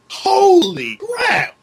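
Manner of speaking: high, loud, drawn-out shock; stunned exclamation. Retold or imitated. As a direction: stretch it slowly in loud disbelief. Exclaimed reaction to the window jump.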